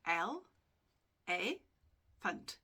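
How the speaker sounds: "L, A, funt" as three separate sounds, long pauses between; split into syllables. Shown as a demonstration. Teacher showing students three syllables, split apart. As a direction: break elephant into "L", "A", "funt", one at a time. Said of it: "there are three syllables".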